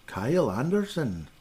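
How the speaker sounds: put-on drawn-out Scottish-accented name. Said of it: "I'm thinking Scotland".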